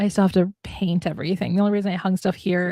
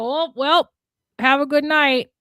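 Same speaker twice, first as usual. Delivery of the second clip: Minnesota goodbye; 6 semitones higher, 4 dB louder, slower than usual. Shown as a demonstration. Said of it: "The Minnesota goodbye".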